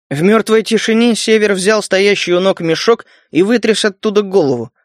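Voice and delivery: plain reading voice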